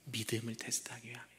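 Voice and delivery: quick, soft whispered reveal; fast whisper. Acted. Sermon drops to whisper.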